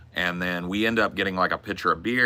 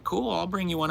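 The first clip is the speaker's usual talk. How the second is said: higher, chirpy server voice; waitress voice; 11 semitones higher than usual. Retold or imitated. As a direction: play the friendly waitress. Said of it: "she was like".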